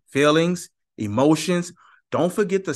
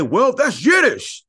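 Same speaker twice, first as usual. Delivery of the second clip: mock dismissive; 10 semitones higher, 7 dB louder, slower than usual. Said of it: "They will say".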